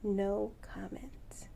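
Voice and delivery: slow whispered "No comment."; whispered bird voice. Retold or imitated. Owner voicing her bird refusing comment, slow whisper. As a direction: whisper it slowly as the silent bird.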